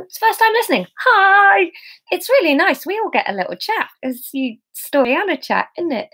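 a new listener gets an excited hello; excited welcome. Real, not acted. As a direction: greet the newcomer with delight.

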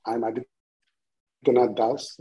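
usual speaking voice

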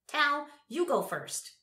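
put-on slow, high, loud old man. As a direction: urge him on like an old man. Old man urging Tal.

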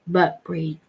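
ordinary delivery

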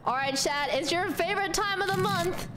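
usual speaking voice

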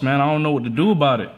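ordinary delivery

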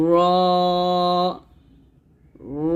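lightly trilled, heavy "র", drawn out; thick, lengthened letter. Shown as a demonstration. Teacher leads students reciting along, "র" thick and lengthened. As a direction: say "র" with a thick, full sound, held one count. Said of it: "মোটা করিয়া এক আলিফ টানে".

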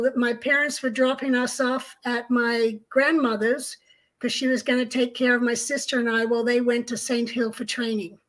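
ordinary talk, nothing marked

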